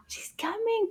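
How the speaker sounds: high, hushed whisper of suspense; excited whisper. Acted. Teacher whispering to the kids that the puppet is coming. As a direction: whisper it like a secret.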